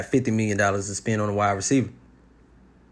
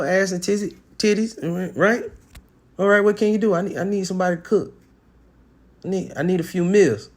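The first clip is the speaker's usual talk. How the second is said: raised voice acting out his own demands; talking himself through the pick, higher; voicing himself picking a woman; 8 semitones higher than usual. Man sizing up a woman, in a raised voice.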